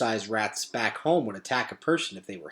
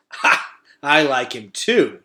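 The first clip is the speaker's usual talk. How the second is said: slow, louder half-choked laugh; choking laugh; 7 dB louder, slower than usual. A narrator's reading. Shed choking with laughter. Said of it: "choked Shed".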